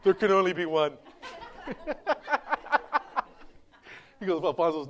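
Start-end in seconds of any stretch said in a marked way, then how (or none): 1.2-3.2 high breathy giggle; rattling wheezy laugh; laughter. Real, not acted. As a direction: laugh helplessly at your own joke. Laughing after own joke.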